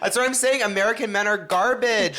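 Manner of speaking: high, loud and laughing; laughing agreement. Real, not acted. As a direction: egg her on through laughter.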